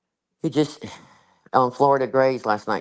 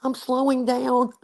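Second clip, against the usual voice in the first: mock exasperated reply; 13 semitones higher than usual. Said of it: "I love your accent".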